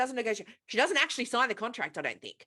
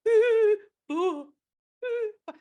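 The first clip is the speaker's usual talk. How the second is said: hammed-up higher moans between numbers; mock-moaning count, louder peaks; 10 semitones higher, 6 dB louder, slower than usual. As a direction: ham up her pained little moans. Mimics woman moaning through spanking.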